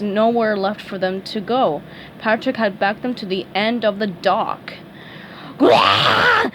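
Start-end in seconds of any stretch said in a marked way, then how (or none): 5.6-6.5 high, loud beastly roar; angry monster yell. A narrator's reading. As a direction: yell like an enraged creature. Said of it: "the angry starfish yelled".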